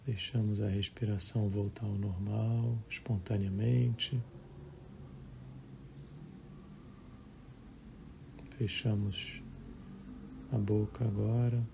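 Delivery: calm, measured meditation voice with long pauses; guided meditation. Acted. Leads a live guided meditation. (voz calma de meditação guiada)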